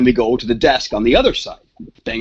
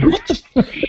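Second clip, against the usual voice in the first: shocked. Retold or imitated. Sees the wrecked room.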